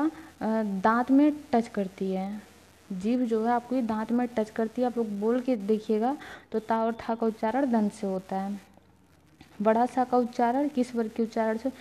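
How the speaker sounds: ordinary delivery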